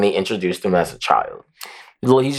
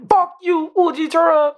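furious yell. Retold or imitated. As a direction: scream it at him in fury. Imitating Akainu yelling at Fujitora.

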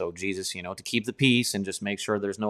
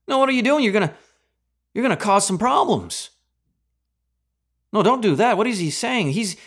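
much higher, louder hammed-up panic; mock alarm; 5 semitones higher, 3 dB louder than usual. Preacher voicing alarmed Pharisees, hammed-up panic. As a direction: play a flustered Pharisee in mock horror.

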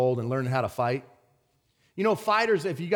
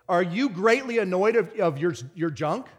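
normal talking voice

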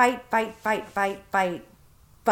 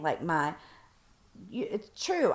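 usual speaking voice

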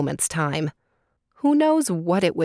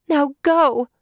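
much higher, pressing frog voice; urgent little frog; 8 semitones higher, 5 dB louder than usual. Acted. Frog urges Collier to hurry, high and urgent. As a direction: hurry her along.

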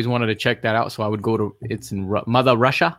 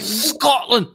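a light attempt at a Scottish accent, pitched high; joking Scottish accent at the table; 9 semitones higher than usual. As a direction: say Scotland the way a Scot would, half-hearted. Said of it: "That's as best as my accent can do".

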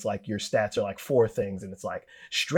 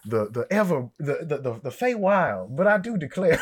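put-on southern accent. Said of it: "a southern accent".

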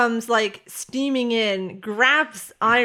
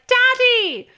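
put-on high, loud little-kid squeal; toddler calling out; 7 dB louder than usual. Toddler spotting dad across the room, high gleeful call. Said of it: "she comes toddling through and she's like".

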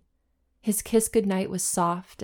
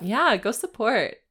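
usual speaking voice

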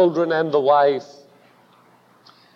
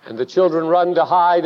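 normal talking voice

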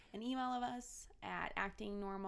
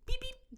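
roadrunner beep; 13 semitones higher, 4 dB louder than usual. Retold or imitated. Imitating the roadrunner.

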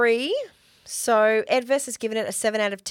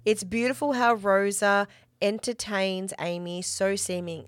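ordinary talk, nothing marked

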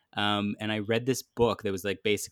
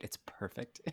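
whispered aside; 16 dB quieter than usual. Real, not acted. Joking about his whitening beard.

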